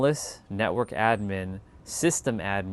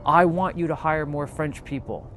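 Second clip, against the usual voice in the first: mock angry lawyer; 5 semitones higher than usual. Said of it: "He's like".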